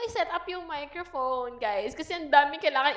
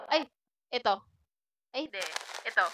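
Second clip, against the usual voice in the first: normal talking voice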